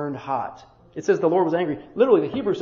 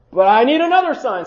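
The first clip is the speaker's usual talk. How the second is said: But I need another sign, higher and louder; insistent demand; 6 semitones higher, 8 dB louder than usual. Acted. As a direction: push another hard, pleading. Gideon insists a third time.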